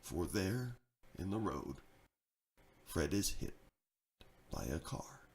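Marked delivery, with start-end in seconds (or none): none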